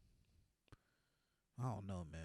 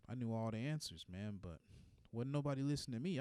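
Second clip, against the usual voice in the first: ordinary talk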